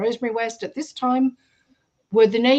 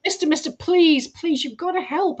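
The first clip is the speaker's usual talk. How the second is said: put-on pleading girl; 6 semitones higher than usual.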